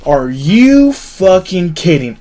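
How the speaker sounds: retold outrage at a stupid question; indignant disbelief. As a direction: throw it back, incredulous and heated. Retelling indignant comeback to the trooper.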